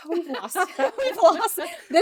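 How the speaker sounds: laughing through the words; giggling mid-complaint. Real, not acted. Laughing while lamenting.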